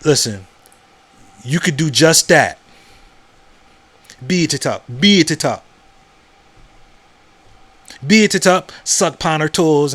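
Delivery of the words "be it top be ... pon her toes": fake Caribbean accent. Joking to viewers in a fake Caribbean accent. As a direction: joke in a mock Caribbean accent. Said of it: "My fake accent".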